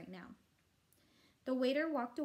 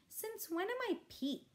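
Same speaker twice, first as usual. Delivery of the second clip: indignant big brother; 12 semitones higher than usual. A narrator's reading. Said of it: "I asked, shaking him off".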